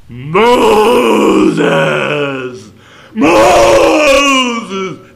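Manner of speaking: huge, high, shouted call from above; God's voice from the old movie, calling Moses in a huge shout; booming movie God voice. Retold or imitated. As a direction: thunder the name like a movie God. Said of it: "That's the voice of God".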